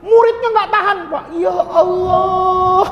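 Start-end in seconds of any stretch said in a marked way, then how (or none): 1.3-2.9 a dismayed disciple's long cry; stretched shout. Acted. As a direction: wail it long, as if betrayed. Student shocked at teacher drinking.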